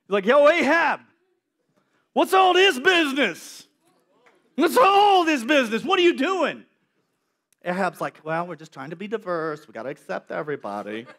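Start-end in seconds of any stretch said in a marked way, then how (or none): 0.2-6.6 calling Ahab out, high and loud; loud confrontation. Retold or imitated. Prophet confronting the king, loud and high. 8.2-11.0 lower, softer mock excuse; a lightly voiced smug king; mock Ahab. Preacher mocking the king's excuse, lower and softer.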